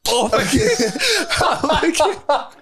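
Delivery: high, laughing disbelief; laughing oh my God. Retold or imitated. Retelling the moment, laughing high in disbelief. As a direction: squeal it through laughter.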